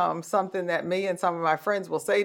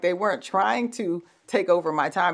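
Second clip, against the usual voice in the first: normal talking voice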